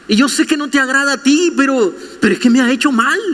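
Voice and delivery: fast, high mock-whiny excuse; whiny prayer. Believer rushing excuses to God, mock whiny. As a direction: rush the excuse, lightly sulky.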